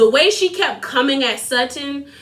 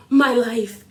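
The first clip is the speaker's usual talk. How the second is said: mock dramatic Erica; 2 semitones lower than usual. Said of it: "You over here like".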